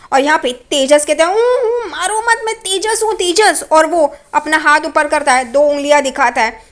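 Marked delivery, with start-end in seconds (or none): 1.3-3.6 high, whimpering imitated plea; desperate pleading. Tejas reveals himself to his attackers, imitated high pleading. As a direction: beg in a much higher voice.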